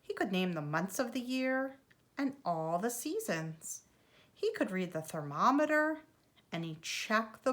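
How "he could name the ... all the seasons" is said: usual speaking voice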